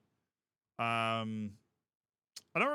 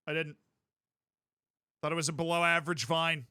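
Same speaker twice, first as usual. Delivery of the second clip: ordinary talk, nothing marked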